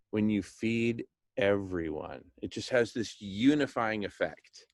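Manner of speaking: normal talking voice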